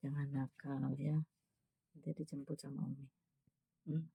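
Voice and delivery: soft, hushed, gentle coaxing; a mother softly gives her youngest son a pep talk before school; tender motherly advice. Real, not acted.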